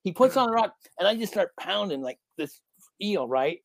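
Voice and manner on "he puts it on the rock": usual speaking voice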